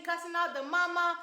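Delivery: usual speaking voice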